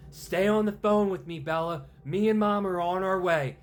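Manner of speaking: high, loud anxious comfort; urgent reassuring dad. Acted. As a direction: keep her on the line, urgently. Father reassuring on the phone.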